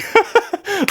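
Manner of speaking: high burst of real laughter. Real, not acted. Laughing at his own character greeting, high giggle. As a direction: crack up at your own impression.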